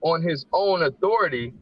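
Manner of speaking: slow, measured reading of the verse; scripture reading. Acted. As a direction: read the holy words slowly and carefully. Reads scripture aloud.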